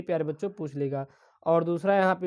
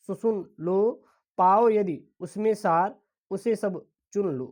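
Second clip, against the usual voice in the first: poem recitation. Acted. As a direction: recite the verse with measured weight. Reads a poem aloud.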